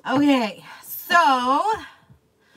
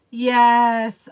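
ordinary talk

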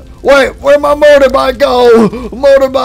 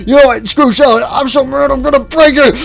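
ordinary talk, nothing marked

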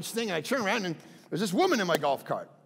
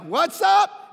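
high, loud, swaggering shout; cocky whoop; 12 semitones higher, 9 dB louder than usual. Retold or imitated. Pastor bragging to golf partners, cocky shout. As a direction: crow like a show-off. Showing off after a great drive.

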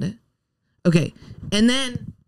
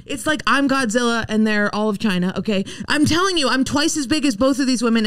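ordinary talk, nothing marked

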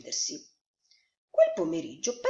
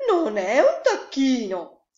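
loud, higher, drawn-out exclamation; mother duck exclaiming; 6 semitones higher, 10 dB louder, slower than usual. A narrator's reading. Mother duck sees the duckling swim, exclaiming. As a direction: cry it out as a thrilled discovery. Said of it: "esclamò la mamma".

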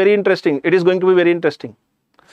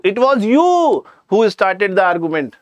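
mock accusation with "you" stretched out; emphasis on YOU; slower than usual. Spouse blaming partner after marriage, drawn-out stress on "you". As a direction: swoop up on "you" and hold it. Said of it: "the other party is going to tell you".

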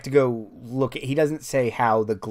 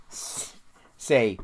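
ordinary delivery

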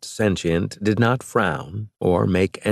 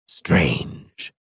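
scratchy voice. A narrator's reading. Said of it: "the scratchy voice said".

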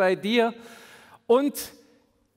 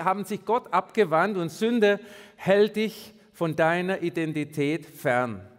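usual speaking voice

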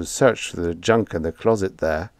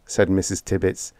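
ordinary delivery, nothing marked

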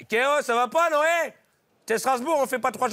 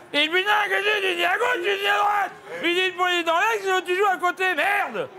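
frustrated yelling; 7 semitones higher than usual. Acted. Frustrated footballer yells.

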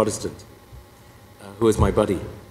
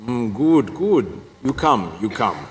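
terse imitated Croatian accent; short, blunt orders in Croatian-accented English; Father Marinko; slower than usual. Pastor summons the visitor to his office, in a Croatian accent. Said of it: "I have to do the accent".

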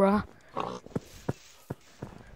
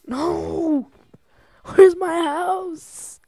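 higher, louder, slow despairing cry; desperate wail; 8 semitones higher, 3 dB louder, much slower than usual. Real, not acted. Player who lost his base, wailing in despair. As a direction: stretch it out in real dismay. House lost, growing panic.

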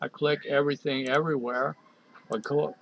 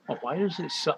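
ordinary delivery

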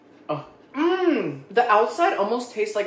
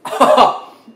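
loud, high surprised laugh; loud burst of laughter; 5 semitones higher, 12 dB louder than usual. Real, not acted. Reviewer biting a crunchy pie, loud burst of laughter. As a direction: crack up at the crunch.

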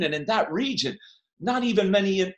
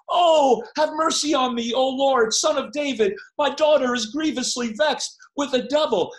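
loud, high pleading shout; mother begging Jesus for her daughter, crying out loud; desperate cry; 7 semitones higher, 3 dB louder than usual. Acted. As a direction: cry out for mercy from outside the house. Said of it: "And she's crying".

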